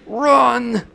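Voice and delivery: loud, high yell to run; urgent shout. Real, not acted. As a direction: shout it in a panic.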